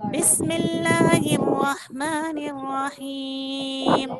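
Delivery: slow, drawn-out Quranic recitation; teacher reciting for children to repeat. Shown as a demonstration. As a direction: chant it slowly and evenly for the class to follow.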